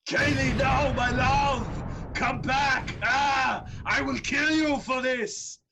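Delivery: grief turning to rage, yelling; anguished shout. Acted. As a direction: scream over a burning loved one. GM voices a grieving, furious character.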